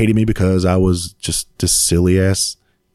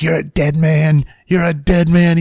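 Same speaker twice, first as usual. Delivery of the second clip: high, drawn-out mock menace; gleeful drill sergeant; 9 semitones higher, slower than usual. Sergeant threatening a doomed recruit, high gleeful imitation. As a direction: get in the recruit's face, high-pitched and gleeful. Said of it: "he just gets in my face".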